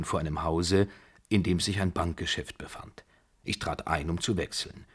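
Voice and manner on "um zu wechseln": plain reading voice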